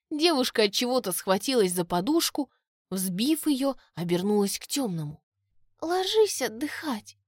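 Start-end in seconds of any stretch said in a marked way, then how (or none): 5.8-7.2 high, slow drowsy murmur; sleepy mumble. A narrator's reading. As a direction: mumble it half asleep. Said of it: "невнятно пробормотала она".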